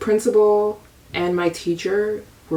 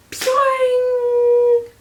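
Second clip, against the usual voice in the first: very high, cartoonish springy boing; playful noise for finally breaking free; boing sound effect; 4 dB louder than usual. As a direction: pop it out like a spring releasing.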